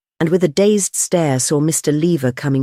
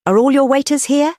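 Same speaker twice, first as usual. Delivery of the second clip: plain reading voice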